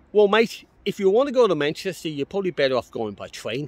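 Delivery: imitating a stubborn passer-by, high and loud; insistent local man. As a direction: push the same advice loudly, voice climbing. Acts out local man's repeated advice.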